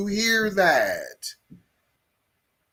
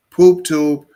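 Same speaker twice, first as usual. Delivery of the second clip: much louder, hammering it home; loud repeat; 10 dB louder than usual. Real, not acted. Repeating it loudly to his podcast listeners. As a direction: bark it out for emphasis. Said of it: "I repeat".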